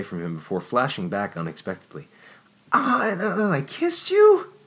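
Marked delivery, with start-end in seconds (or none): 2.7-4.5 high, slow stammering disbelief; flustered shock. A narrator's reading. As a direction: stammer it out, mortified. Flashing back, shocked.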